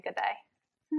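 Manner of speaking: a playful, quiet Australian "Good day" with "Good" stressed and the accent only lightly put on; mock Australian. Shown as a demonstration. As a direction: say "Good day." the way Aussies do, half-joking. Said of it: "I can only say".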